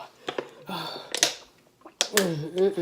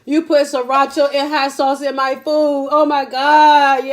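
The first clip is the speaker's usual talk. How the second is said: excited; 4 semitones higher, 5 dB louder than usual. Real, not acted. Mouth burning after prank.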